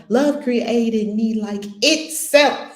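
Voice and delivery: slow line landing hard on "itself"; stress on ITSELF. Shown as a demonstration. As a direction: punch both halves of "itself", loudly. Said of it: "Emphasis on each word".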